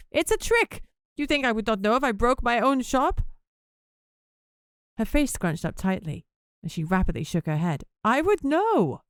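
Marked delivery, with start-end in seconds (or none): 0.1-3.2 high, rising indignant insistence; upset protest. A narrator's reading. 8.0-9.0 indignant insistence. A narrator's reading. Flo insists, head shaking.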